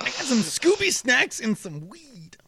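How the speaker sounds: high cartoon stoner voice; goofy slacker offering treats to his dog; put-on squeaky cartoon impression; Shaggy. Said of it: "What about Shaggy?".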